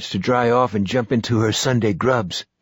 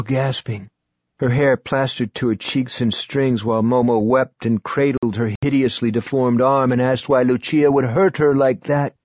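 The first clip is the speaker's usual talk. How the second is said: ordinary delivery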